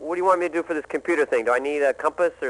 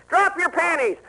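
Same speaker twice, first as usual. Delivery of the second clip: Ross Perot impression; 12 semitones higher than usual. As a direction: crow it like a brash Texas tycoon. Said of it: "as Ross Perot and say".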